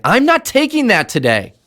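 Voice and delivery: high, emphatic, stress on not; fully aligned, forceful declaration; real thrust and energy. Shown as a demonstration. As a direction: slam the door on doubt. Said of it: "Say it with real thrust and energy".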